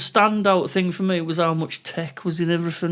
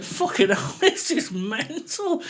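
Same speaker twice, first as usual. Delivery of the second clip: amazed; 6 semitones higher, much slower than usual. Retold or imitated. Retells amazed reaction at karaoke place.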